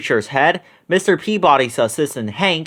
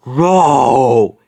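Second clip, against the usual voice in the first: fierce monster growl; 6 dB louder than usual. A narrator's reading. Marsh monster growling at the gang, louder fierce roar. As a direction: roar to scare the kids. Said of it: "it growled fiercely".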